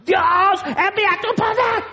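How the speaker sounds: very high, strained mock yell; hammed-up screaming plea; imitated shouted prayer. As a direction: holler at God in parody. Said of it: "ya le estás gritando".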